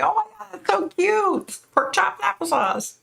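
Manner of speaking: high squealing aww, that's adorable; gushing over cute names. Retold or imitated. To viewers, retelling her reaction to dog names, high gushing squeal. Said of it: "I was like".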